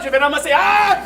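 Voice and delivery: ordinary talk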